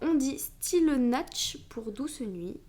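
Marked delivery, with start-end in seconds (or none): 0.4-1.6 « Stille Nacht » dit lentement, à l'allemande; détacher « Stille Nacht » avec soin; prononciation allemande. Shown as a demonstration. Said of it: "excusez-moi pour mon accent". (prononcer « Stille Nacht » lentement, avec un accent allemand)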